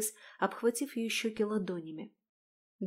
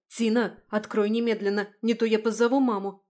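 loud stern demand; threatening; 7 dB louder than usual. Acted. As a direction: order her to open up. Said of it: "пригрозила Марианна". (громкая угроза)

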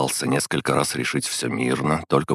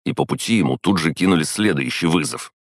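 usual speaking voice

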